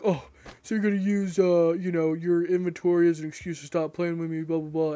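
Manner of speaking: a gamer mocking a cheater who feels rejected, whiny; mocking imitation. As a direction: mimic him as petulant and put-upon. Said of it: "he's like".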